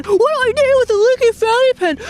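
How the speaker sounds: high-pitched exasperated outburst, ending in a gasp; mock freak-out. [name] handed a useless leaky pen, hammed-up panic. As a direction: freak out theatrically over nothing. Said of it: "stop freaking out".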